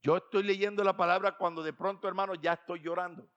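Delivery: ordinary talk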